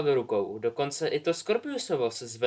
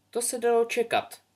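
put-on a much higher, other girl's voice remarking; dry remark; 11 semitones higher than usual. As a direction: toss it off as a knowing classmate. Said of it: "poznamenala Poličempová".

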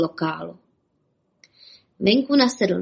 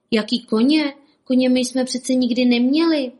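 higher-pitched, baffled little rabbit; puzzled astonishment; 4 semitones higher, 4 dB louder than usual. A narrator's reading. Bobek answering the sheriff, amazed and higher-voiced. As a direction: protest in surprise at the very idea. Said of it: "divil se Bobek".

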